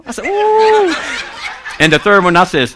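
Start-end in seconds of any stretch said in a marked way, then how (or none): 0.3-0.9 high, drawn-out swooning ooh; long high coo of pretend infatuation; mock-smitten ooh. Waiter pretending to fall for old lady, smitten ooh.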